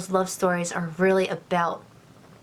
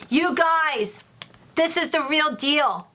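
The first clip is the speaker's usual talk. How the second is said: excited; 6 semitones higher than usual. Real, not acted. Reader announces real deal.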